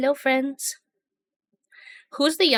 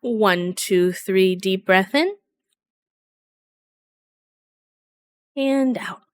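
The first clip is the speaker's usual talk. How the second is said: guided breathing; much slower than usual. Acted.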